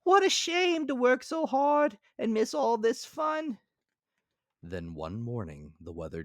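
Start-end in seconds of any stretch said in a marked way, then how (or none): 0.0-3.6 high, loud cartoon grasshopper; bright piping insect voice. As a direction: squeak it out as the carefree grasshopper. Grasshopper character voice.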